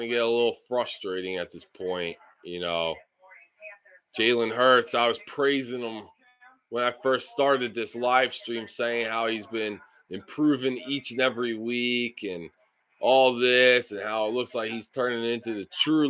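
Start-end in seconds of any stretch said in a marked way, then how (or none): none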